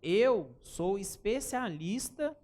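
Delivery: slow, deliberate and emphatic; proud declaration, each word weighed; confident claim. Shown as a demonstration. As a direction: say it slowly like you mean it. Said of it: "Falar assim, ó".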